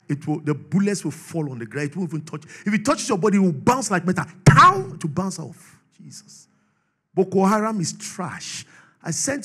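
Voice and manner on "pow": sharp high shouted pow; mock gunshot pow. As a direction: snap out a mock ricochet. Imitating bullets bouncing off.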